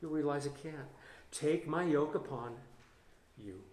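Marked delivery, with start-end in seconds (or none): 1.3-3.6 soft tender reading. Acted. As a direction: read it softly, like comfort. Reading Jesus' words softly.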